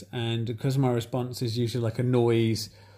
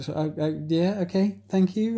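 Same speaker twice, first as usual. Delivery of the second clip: rising higher with each word; awkward forced thanks; 7 semitones higher than usual. Retold or imitated. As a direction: stammer into a squeaky thank you. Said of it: "And it's like".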